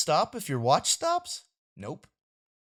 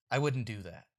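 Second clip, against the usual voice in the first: ordinary delivery, nothing marked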